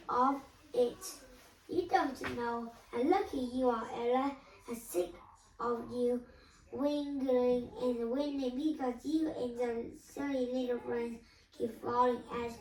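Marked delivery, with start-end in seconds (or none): none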